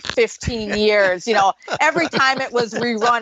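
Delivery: ordinary talk, nothing marked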